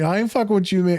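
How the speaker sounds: high-pitched, playful brush-off; mock refusal. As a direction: imitate a client backing away. Retold client refusing again.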